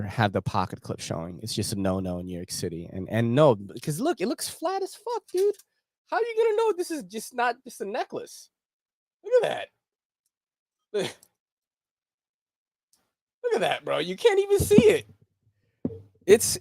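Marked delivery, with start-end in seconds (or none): 4.2-14.0 excited. Real, not acted. Excited showing off a necklace knife. 14.0-16.6 excited. Real, not acted. Excited showing off a necklace knife.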